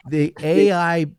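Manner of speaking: ordinary delivery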